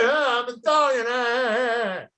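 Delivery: a lightly hammed Italian accent, barely shifted; mocking the governor's Italian excuse, hammed-up Italian accent. To cohost, mocking the governor's excuse in a hammed-up Italian accent.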